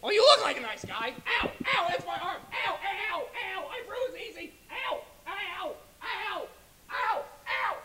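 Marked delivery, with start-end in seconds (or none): 1.4-7.8 high yelps, receding; pained yelps. Acted. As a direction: yelp while being dragged off. Auditioner dragged away by guards.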